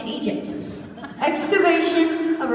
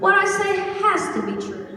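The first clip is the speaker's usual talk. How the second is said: raised-pitch pompous insistence; official, authoritative insistence; 7 semitones higher than usual. Acted. Announcer defending his facts to a scout, authoritative insistence. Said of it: "I'm speaking in an official author-, authoritative voice".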